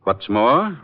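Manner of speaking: ordinary talk, nothing marked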